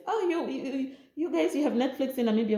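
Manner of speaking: the man doubting Namibia has Netflix, mock surprise; mocking surprised man. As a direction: imitate his disbelief, quick.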